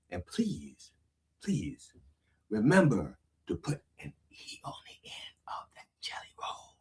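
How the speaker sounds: very soft, fading to a whisper; hushed plea. Acted. Reverend begging listeners about his email, hushed. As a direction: plead softly, letting it die away.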